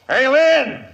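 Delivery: calling out. Acted. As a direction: shout the name high and loud after a rider. Calling after a departing rider.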